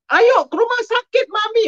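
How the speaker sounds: high-pitched urgent retold call. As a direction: imitate neighbours urging her to hospital, pitch jumping up. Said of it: "mereka panggil". (seruan mendesak bernada tinggi)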